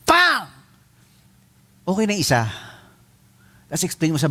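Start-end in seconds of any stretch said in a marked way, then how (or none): 0.1-0.5 high sharp pang like a slipper smack; mock slipper smack; slap sound effect. Shown as a demonstration. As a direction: snap out the sound of a hit. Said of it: "tsinelas na lang".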